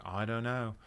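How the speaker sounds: put-on a Liverpool-sounding stab at an Australian accent. Reviewer voicing [name]'s shrug of a reply, wobbly Australian accent. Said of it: "that was like a Beatles impression".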